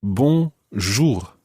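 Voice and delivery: Bonjour, clear French model. Shown as a demonstration. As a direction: model Bonjour slowly for the class. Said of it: "So bonjour literally means good day".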